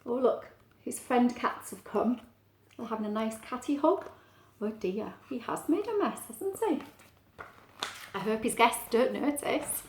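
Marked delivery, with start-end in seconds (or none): none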